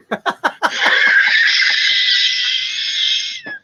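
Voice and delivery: high breathy burst of laughter. Real, not acted. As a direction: crack up laughing. Laughing at Drew's attempt.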